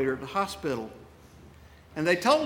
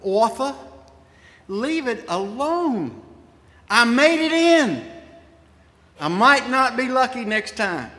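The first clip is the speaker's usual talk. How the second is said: put-on high, ringing Orangeburg accent, calling out; 5 semitones higher, 4 dB louder than usual. Preacher voicing his late friend from the other side, in an Orangeburg accent. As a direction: call across the water in an Orangeburg accent. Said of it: "say to me in his Orangeburg accent".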